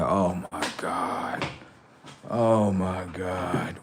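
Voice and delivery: low, very slow, soft groan; re-enacted weary disbelief; exasperated dismay. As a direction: imitate a brother who can't believe it, dragging each word. Brother exasperated by the answer.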